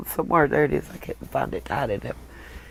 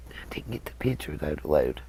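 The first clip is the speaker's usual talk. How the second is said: whispering; 14 dB quieter than usual. Real, not acted. Muttering while reading the phone.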